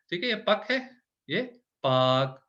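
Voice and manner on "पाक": पाक said with a long stretched aa; long vowel on पाक; long a in paak. Shown as a demonstration. Teacher shows the long aa in पाक. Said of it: "अलिफ लग गया तो पाक हो गया".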